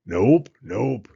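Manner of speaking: loud grumbling beaver; a grumpy beaver shaking his head. A narrator's reading. Beaver correcting Owl, a loud grumble. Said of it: "grumbled Beaver".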